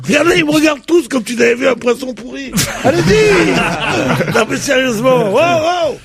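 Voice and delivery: high-pitched calling out; mock exasperation. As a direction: rouse a dozy audience. Scolds the unresponsive panel.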